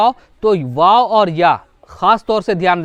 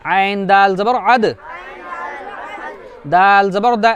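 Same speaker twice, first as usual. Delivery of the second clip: spelling drill. Shown as a demonstration. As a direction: chant each letter slowly for the class. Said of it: "उसके आगे देखो".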